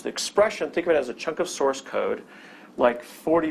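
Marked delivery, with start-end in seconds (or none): 0.0-0.7 expression lifted high and leaned on; stress on expression. Shown as a demonstration. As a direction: pitch the word expression up high to underline it. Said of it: "emphasize the word expression".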